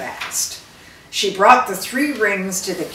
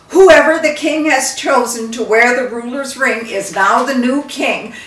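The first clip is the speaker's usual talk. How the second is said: louder, ringing announcement; royal proclamation; 5 semitones higher, 10 dB louder than usual. Acted. Queen announcing the new king at the funeral, loudly. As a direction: proclaim it to the whole court.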